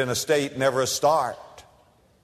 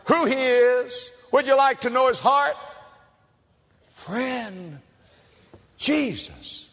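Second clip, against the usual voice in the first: rising, impassioned preacher's call, pitched higher; preaching at full force; 8 semitones higher than usual. Acted. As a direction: lift the congregation with a soaring appeal. Preaching climax.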